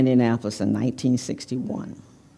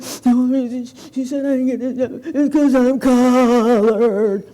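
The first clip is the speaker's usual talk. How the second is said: retold tearful words; 8 semitones higher, 4 dB louder than usual. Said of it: "and I'm still crying".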